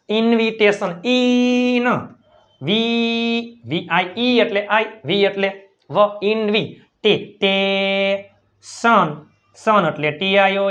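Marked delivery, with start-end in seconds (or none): 1.0-3.6 ઇન and વી held long, higher, as separate syllables; sounded-out demo. Shown as a demonstration. Teacher giving an example, ઇન વી sounded out long and high. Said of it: "Just example". 7.0-8.3 ટે said once short, then held very long; sounded-out demo. Shown as a demonstration. Teacher giving an example, ટે drawn out slowly. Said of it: "Just example".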